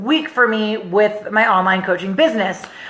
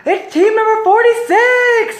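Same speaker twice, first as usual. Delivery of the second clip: high, drawn-out announcer call; imitated raffle announcer; 12 semitones higher, 3 dB louder, slower than usual. Host announcing the drawn team, excited announcer call.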